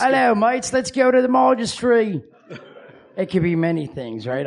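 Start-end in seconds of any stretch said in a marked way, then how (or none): 0.0-2.2 high, put-on British voice; rough British-Australian greeting; attempted British accent. Shown as a demonstration. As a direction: try a British accent pitched up. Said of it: "what's your British voice sound like".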